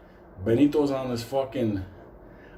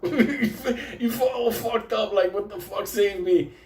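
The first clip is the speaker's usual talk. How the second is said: high-pitched, laughing mock desperation; desperate plea; 9 semitones higher, slower than usual. Survivor begging the rising sun, desperate high plea. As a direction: plead high, half laughing. Acting out desperate hope at sunrise.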